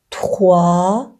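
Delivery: تخوا said slowly with a uvular r; French trois. Shown as a demonstration. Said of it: "همون دو". (تخوا آهسته با ر فرانسوی)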